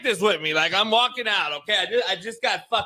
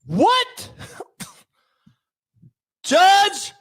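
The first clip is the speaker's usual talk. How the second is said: high, loud, slow desperate begging; pleading shout; 10 semitones higher, 4 dB louder, much slower than usual. Pretend. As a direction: beg the judge to lock you up, yelling.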